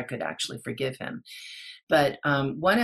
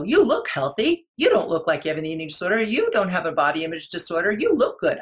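imitating therapists, loud, quick and pitched up; mock-reassuring, rapid-fire therapist voice; dismissive therapists; 3 semitones higher, 6 dB louder, faster than usual. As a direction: brush off the patient with high, loud assurances. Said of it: "and they go".

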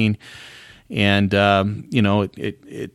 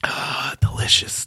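breathy whisper of delight; whispered relish; slower than usual. Pretend. After the mock drink, whispering delight over it. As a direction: whisper it like savoring a sip.

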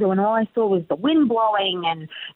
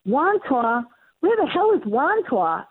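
put-on high, lost and bewildered girl voice; hammed-up Dorothy from Oz, high and lost; mock Dorothy voice; 9 semitones higher than usual. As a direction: play a confused Dorothy who landed somewhere strange. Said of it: "I was like".

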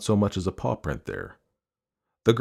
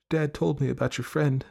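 light, higher, gentle voice; soft, tired mother; 8 semitones higher than usual. A narrator's reading. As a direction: broach it softly with your daughter. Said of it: "It was soft and tired and light".

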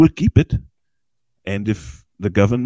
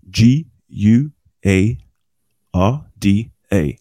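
spelled out slowly; much slower than usual. Shown as a demonstration. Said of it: "spelling app name slowly".

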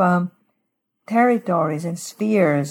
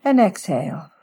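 guided meditation. Shown as a demonstration. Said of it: "let me just walk through a little prayer with our listeners".

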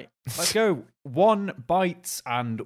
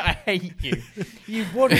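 louder, higher joking rant through laughter; mock anger; 4 semitones higher, 3 dB louder, slower than usual. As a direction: playfully curse the furniture while laughing. Acted rage at furniture.

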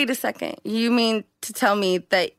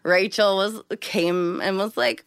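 ordinary delivery

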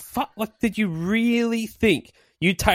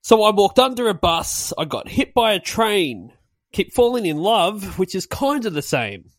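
reciting lyrics; 5 semitones higher than usual. Shown as a demonstration. Quoting the song's chorus to the others, recited higher. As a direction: read the chorus out with a sing-song lilt. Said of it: "the chorus is".

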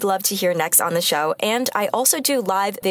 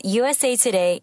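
ordinary talk

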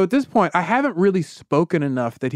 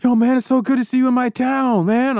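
excited fan; 10 semitones higher than usual. Retold or imitated. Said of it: "He's like".

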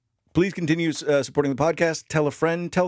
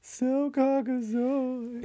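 drawn-out, much higher mock posh voice; 12 semitones higher, 4 dB quieter, much slower than usual. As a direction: stretch it out in a silly high put-on voice.

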